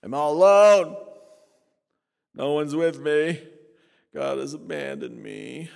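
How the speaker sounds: lightly voiced despairing believer, loud at first; mock self-pity. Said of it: "Christians walk around acting like pagans".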